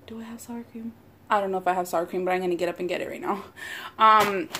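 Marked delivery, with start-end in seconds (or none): 0.1-0.9 muttered to herself. Real, not acted. Repeats question under her breath.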